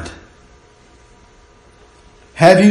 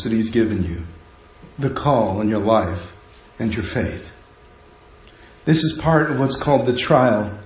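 softer, lower sermon voice over music; hushed preaching; 7 semitones lower, 5 dB quieter than usual. Acted. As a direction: draw the congregation in quietly.

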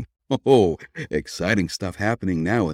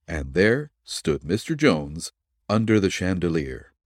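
ordinary delivery

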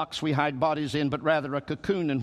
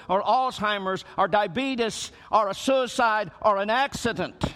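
raised, ringing preacher's cry; preaching; 6 semitones higher than usual. Acted. Pastor preaching resurrection hope to grieving listeners, raised and ringing. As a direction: preach it out high to the congregation.

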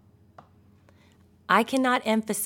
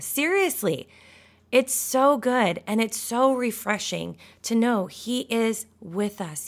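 ordinary delivery

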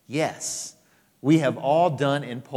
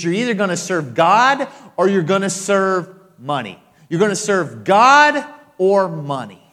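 loud, raised preaching of the quote; loud preacher voicing Jesus; forceful quote; 5 semitones higher, 8 dB louder than usual. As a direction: drive the ultimatum home.

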